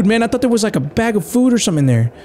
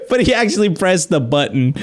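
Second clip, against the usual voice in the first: laughing; 5 semitones higher than usual. Real, not acted. Laughing at the cat's action.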